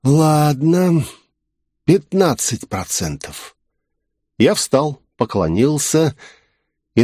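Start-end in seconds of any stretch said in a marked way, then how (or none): 0.0-3.6 slow drawn-out snake voice; drawling serpent. Acted. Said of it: "змей протянул".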